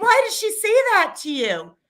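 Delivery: high-pitched outraged question; acted indignation. Mother reacts to what a friend told her daughter, acted indignation. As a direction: voice leaping up in retold disbelief.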